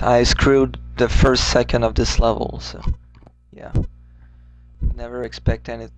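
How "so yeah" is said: usual speaking voice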